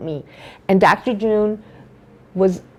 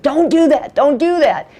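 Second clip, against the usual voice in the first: higher, urgent retold plea; imitated alarm; 6 semitones higher than usual. Lab tech stopping the dentist as she cries, imitated alarm. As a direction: act out a mildly alarmed intervention.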